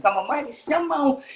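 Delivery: high-pitched pop-star ad-lib. Retold or imitated. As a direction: throw out a high imitation of a singer's catchphrase. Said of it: "[name] was still out there shamoning".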